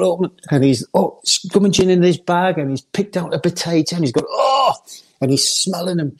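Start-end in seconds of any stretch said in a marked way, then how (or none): 4.3-4.7 delighted exclamation. Retold or imitated. Cloughie delighted by potato.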